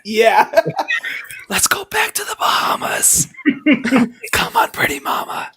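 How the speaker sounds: whispered mock seduction; teasing, drawn-out stage whisper; playful whisper. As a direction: whisper it like a siren's call. Playful whispered serenade as the palate.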